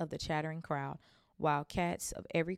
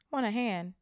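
higher, louder offer; friendly twin; 5 semitones higher, 5 dB louder than usual. Acted. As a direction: offer help breezily.

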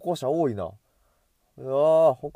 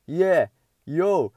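loud, high party whoop; hyped cheer; 4 semitones higher, 7 dB louder, slower than usual. Shown as a demonstration. Vlogger hyping himself up with a loud whoop. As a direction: whoop it up to pump yourself up. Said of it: "テンション高いね".

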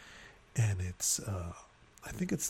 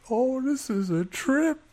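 high, drawn-out marvelling; amused delight; 12 semitones higher, 3 dB louder, slower than usual. Retold or imitated. Husband laughing at the wedding fight, amused delight. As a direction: savour the chaos in a raised pitch. Amused reply to upset wife.

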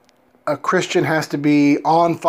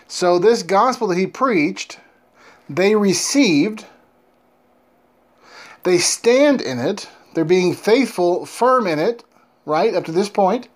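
slow, emphatic, higher preaching; 4 semitones higher than usual. Acted. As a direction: preach it slowly with weight.

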